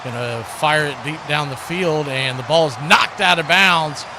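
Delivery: ordinary talk, nothing marked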